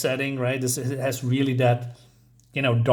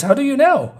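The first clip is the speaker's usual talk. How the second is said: shocked shout; 11 semitones higher, 7 dB louder than usual. Acted. Harker shouting at Dracula's knowledge.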